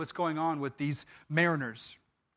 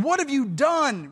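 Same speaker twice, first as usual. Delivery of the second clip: alarmed sailors; 6 semitones higher than usual. Acted. Frightened sailors confront Jonah.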